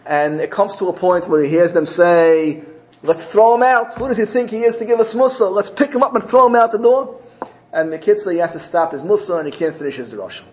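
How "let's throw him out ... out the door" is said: high, loud heckling from angry townsfolk; indignant crowd. Retold or imitated. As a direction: imitate a crowd turning on the preacher. Acting out angry townspeople heckling preacher.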